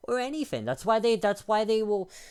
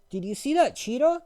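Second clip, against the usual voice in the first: much higher, eager mock question; mocking dumb voice; 11 semitones higher, 4 dB louder than usual. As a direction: squeak it out like a clueless fan. Mocking people who call cats cheetahs.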